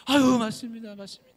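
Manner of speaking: overjoyed. Acted. Woodcutter recognizing his wife, joyful loud cry. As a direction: cry out in relief at finding her.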